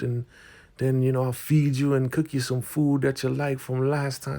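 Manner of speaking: high, put-on reassuring voice; coaxing elder. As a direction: imitate an elder urging a reluctant guest to try it. Marva coaxes Lynn to try outhouse.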